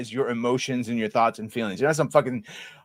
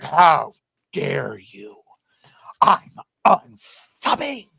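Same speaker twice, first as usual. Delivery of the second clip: mock outraged Canadians; 4 dB louder, much slower than usual. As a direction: play the offended viewer. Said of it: "everyone's like".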